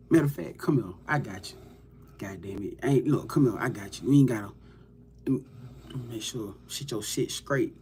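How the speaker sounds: hushed and close, as if helping someone up; quiet, tender mock consoling; soft comforting murmur.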